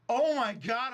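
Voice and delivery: high, stunned gasp of disbelief; shocked. Retold or imitated. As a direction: react like you caught them red-handed. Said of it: "I'm like".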